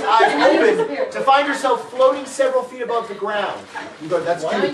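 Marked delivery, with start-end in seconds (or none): none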